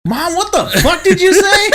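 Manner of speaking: high, loud mock yell with laughter; yelling from room. Friend imagines son calling to mom from his room, yelling. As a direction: holler it as if through the house. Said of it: "you just yelling from your room".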